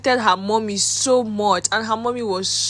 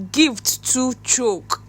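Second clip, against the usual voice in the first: "Gift to choke" drawn out slowly, every word leaned on; emphatic slow drawl; 3 semitones higher, much slower than usual. Real, not acted. Vlogger stressing the pile of birthday gifts, slow emphatic drawl. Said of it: "I'm emphasizing on that chokeness".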